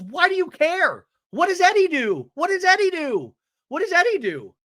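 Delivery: high, loud, repeated demand; mock angry challenge. Jen challenges Tamara about Eddie, high mock yelling. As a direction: hammer the question, imitating a shrill quarrel. Jen's shouted challenge acted out.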